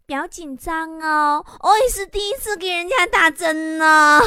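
slow, drawn-out mock-coquettish voice; seductive. Nurse giving her first injection to a patient, hammed-up seductive coo. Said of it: "是不是特别妩媚的对你说".

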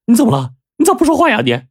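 high, urgent, baffled questioning; alarmed questioning. A narrator's reading. Husband pushes silent wife away and asks, alarmed. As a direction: press for an answer in bewilderment.

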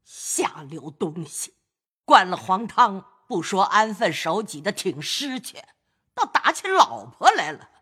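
put-on high, scornful scolding old lady; spitting scolding. Grandmother scolding grandson for beating his wife, contemptuous. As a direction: spit the words out at the grandson in contempt. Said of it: "贾母啐道".

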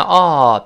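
open, long "哦" vowel, like aw; full vowel. Shown as a demonstration. Teacher shows the unweakened vowel, full sound. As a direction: hold "哦" as a rounded aw. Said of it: "弱化，由原来哦变成呃".

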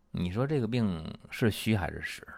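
ordinary delivery

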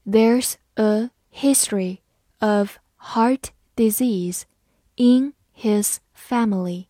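slow, careful reading, every word clear; read slowly. Shown as a demonstration. Teacher slowly reads the example sentence for students, slow and clear. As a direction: spell the sentence out slowly for learners. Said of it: "慢慢来读".

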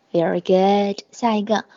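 normal talking voice

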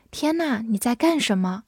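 ordinary talk

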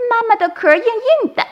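put-on very high, childlike exclamation; baby turtle voice. A narrator's reading. Naughty baby turtle taps mum's shell, childlike delight. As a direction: pipe up like a tiny, cheeky hatchling.

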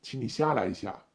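low, loud male-driver role; stern driver. A narrator's reading. Driver ordering the frightened passenger out, stern. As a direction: give the order low and firm. Driver repeats his request.

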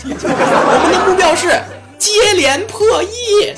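high, slow rallying cry; grand proclamation. Acted. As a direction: announce it like a slogan. Proclaiming his grand goal.

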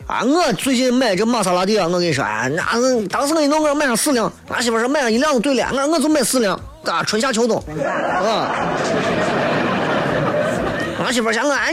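high, brash bragging upstart voice; nouveau riche show-off. Retold or imitated. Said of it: "你看他张嘴说话，以没有文化为荣". (土豪暴发户的炫耀腔)

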